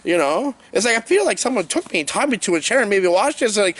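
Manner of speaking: ordinary talk, nothing marked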